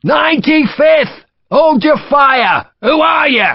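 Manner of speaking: high, slow, called-out identification; shout. Acted. Sharp shouting to stop friendly fire. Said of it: "he shouted".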